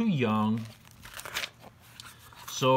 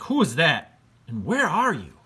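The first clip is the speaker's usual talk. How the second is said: put-on higher, louder startled kid voice; startled boy George; 7 semitones higher, 6 dB louder than usual. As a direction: call out into the dark, alarmed. Boy meets a voice in the dark.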